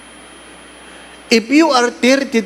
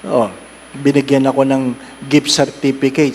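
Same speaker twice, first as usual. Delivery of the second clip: usual speaking voice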